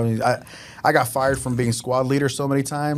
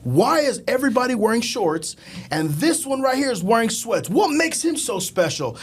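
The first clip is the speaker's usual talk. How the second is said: demanding first sergeant; 8 semitones higher than usual. Retold or imitated.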